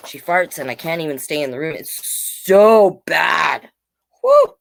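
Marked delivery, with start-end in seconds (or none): 1.8-4.5 hammed-up disgust; mock disgust. Real, not acted. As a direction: play up the stink. Joking about cat farts.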